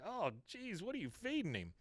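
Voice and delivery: high, quiet groan of revulsion; mock disgust. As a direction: imitate someone grossed out. Picking up a big dog poo.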